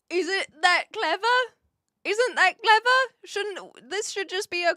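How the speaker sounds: high, loud astonished question; amazed. Real, not acted. Amazed streamer reacting to the blanket term. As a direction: marvel out loud at the word.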